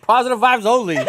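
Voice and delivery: high, loud laughing joke. Real, not acted. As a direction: say it cracking up. Joking, laughing.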